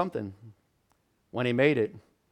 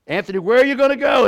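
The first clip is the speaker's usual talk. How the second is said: louder, faster, pressing the question; urgent repeated question; 8 semitones higher, 6 dB louder, faster than usual. Retold or imitated. Father presses son a third time.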